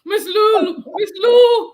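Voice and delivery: high, loud laughing cry; laughing exclamation. Real, not acted. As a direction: squeal the name through laughter. Laughing, calling out the name.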